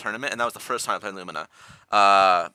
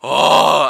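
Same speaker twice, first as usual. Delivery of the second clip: loud, raised, drawn-out "oh"; imitating dad's "oh"; 6 semitones higher, 11 dB louder than usual. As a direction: belt out a long mock "oh" like dad. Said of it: "he will go around and just say".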